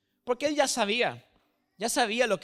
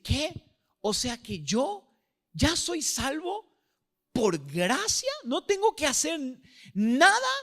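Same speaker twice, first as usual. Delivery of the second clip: rising higher and higher in amazed wonder; astonished disbelief; 5 semitones higher than usual. Retold or imitated. Believer realising salvation is free, rising amazed disbelief.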